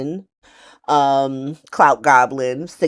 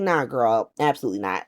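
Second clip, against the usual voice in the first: dismissive refusal; 7 semitones lower, 5 dB quieter than usual. Retold or imitated. John Oliver refusing Carly.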